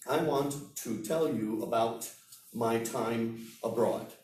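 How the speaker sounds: every word separate, full "to" in "want to"; slow it down, each word pronounced on its own; unnatural, unconnected speech. Shown as a demonstration. Teacher showing students unnatural speech, word by word. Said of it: "Sounds very unnatural".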